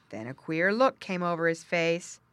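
ordinary delivery, nothing marked

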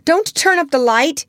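put-on scared boy's high-pitched protest; frightened cry. A narrator's reading. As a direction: cry out to stop her. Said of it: "he cried".

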